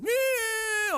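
high, loud mock toddler yell; toddler shout. Preacher imitates himself as a crying toddler, shouting. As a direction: bawl it like a two-year-old. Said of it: "when I was two".

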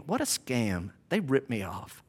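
low, muttered grumble of being cheated; quietly disgusted, imitating a sore customer; mad, cheated worshipper. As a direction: grumble it under your breath. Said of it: "And they're mad about it".

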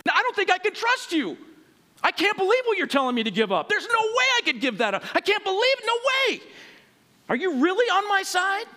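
doubtful protest. Retold or imitated. Doubter speaking to Jesus.

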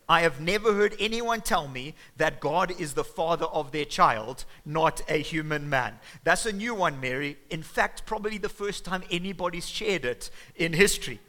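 mock her story, loud and cutting; loud sarcastic disbelief. Joseph scoffing at Mary's claim of God's child, loud and sarcastic.

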